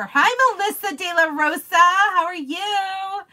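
high, loud, sing-out hello; thrilled welcome, drawn out at the end; excited greeting. Real, not acted. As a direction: call out delightedly, stretching the last words. Greets viewer joining the live.

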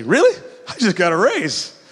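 high, loud, bright "really"; mock the tactless bragger; mock excited brag. Tactless reply to grieving person.

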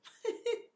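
sudden high, rueful laugh. Real, not acted. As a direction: laugh helplessly at yourself. Laughs at having scared him.

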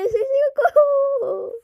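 slow high laughing whine; laugh-crying wail. Acted.

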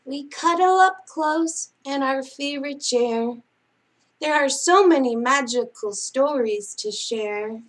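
singsong picture-book reading; bedtime story reading. Acted. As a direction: read it like a lullaby to sleepy kids. Reads bedtime picture book aloud.

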